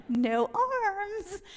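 very high, drawn-out mock plea; high comic wail. Friend reminding her she needs help, high comic wail. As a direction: sing out the helpless reminder high.

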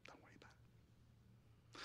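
hushed whisper, barely breathed; a quick hushed aside. Retold or imitated. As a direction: whisper it so he won't hear. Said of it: "the universal sign for it".

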